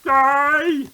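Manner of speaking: high loud cheer. Real, not acted. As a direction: cheer in triumph. Cheering victory.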